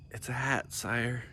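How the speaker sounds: soft, timid stammer. A narrator's reading. Frightened boy answering the king, soft and stammering. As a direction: barely get the words out in fear. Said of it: "he stammered".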